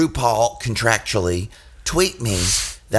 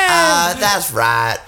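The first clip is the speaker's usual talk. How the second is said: slow gloating; 5 semitones higher, 5 dB louder, much slower than usual. Acted.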